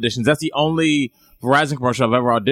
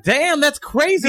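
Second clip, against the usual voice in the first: amazed; 12 semitones higher, slower than usual. Real, not acted. Amazed reaction.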